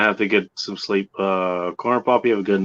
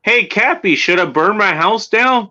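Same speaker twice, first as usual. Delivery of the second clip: much higher, dumb asking voice; mocking clueless player; 9 semitones higher than usual. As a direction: play the naive one asking. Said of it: "what was the proxy's like".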